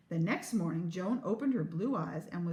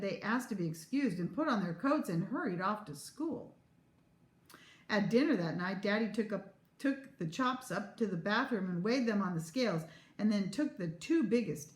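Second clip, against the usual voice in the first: ordinary delivery, nothing marked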